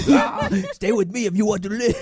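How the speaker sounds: high, loud Austrian action-hero impression; Arnold Schwarzenegger. Joining the bit, loud action-hero impression. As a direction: bellow it in a thick Austrian accent. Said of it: "how to do Arnold Schwarzenegger".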